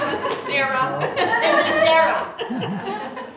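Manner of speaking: delighted laughing, slow at the end. Real, not acted. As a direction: present her through your laughter. Laughing while pointing out Sarah.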